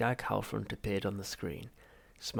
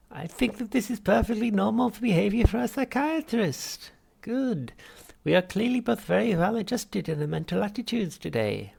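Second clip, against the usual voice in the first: much higher, louder unctuous therapist; Halfrunt voice; 11 semitones higher, 8 dB louder than usual. Acted. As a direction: greet the patient with oily cheer. Psychiatrist voiced.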